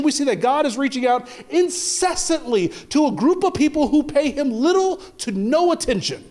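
high, ringing preacher's climb; full-force sermon preaching. Acted. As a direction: preach it out to the congregation.

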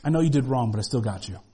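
put-on the Father comforting a child who did wrong; tender reassurance. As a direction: gently tell them you still have them. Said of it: "on the other side going".

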